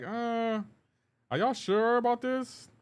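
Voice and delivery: high, drawn-out doubtful question; skeptical reaction. Retold or imitated. Viewer reacting to the Mortal Kombat trailer, skeptical. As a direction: draw it out high, unconvinced.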